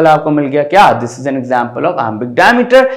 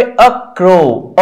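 light a, weight on crow; stress on crow. Shown as a demonstration. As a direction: pass lightly over a and lean on crow. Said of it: "अ unstressed हुआ".

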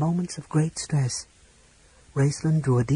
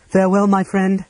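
louder, higher farewell; 6 semitones higher, 7 dB louder than usual. A narrator's reading. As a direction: call it out for all to hear. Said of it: "she said loudly".

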